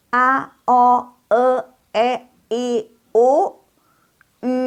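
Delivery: A, O, E, I, U, spaced, high and level; vowel drill. Shown as a demonstration. Leading pupils in reading vowels together, drawn out high and level. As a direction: sustain each vowel from A to U on one high pitch. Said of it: "yuk sama-sama".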